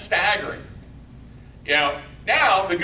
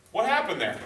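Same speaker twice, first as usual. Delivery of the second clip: normal talking voice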